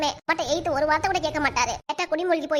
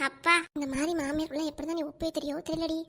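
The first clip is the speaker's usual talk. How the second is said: hushed muttering; 7 dB quieter than usual. Acted. Friend pities her over the mother-in-law, hushed muttering. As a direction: murmur it under your breath, out of the mother-in-law's earshot. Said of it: "என்னடி அங்க குசு குசு".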